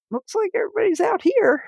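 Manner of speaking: retold remark in a very high voice; high, awkward hint to the family; high-pitched hinting. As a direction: pitch it way up, feigning innocence. Nurse nervously hinting to the family.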